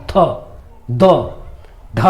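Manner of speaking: थ, द, ध with the tongue touching the teeth; isolated dental थ, द, ध, paused between each; dental sounds demonstrated. Shown as a demonstration. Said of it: "जीभ दांत में touch कर रहा है".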